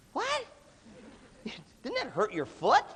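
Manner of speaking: high incredulous squeak that breaks into a laugh; mock disbelief. Retelling the guy kicking his bag, in mock disbelief. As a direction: play up the disbelief, half laughing. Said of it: "And I'm like".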